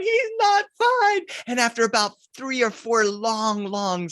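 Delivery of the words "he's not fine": high, slow wailing shout; wailing kid. Retold or imitated. Kids wailing at uncle Tom, high crying shout. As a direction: wail it like a terrified child.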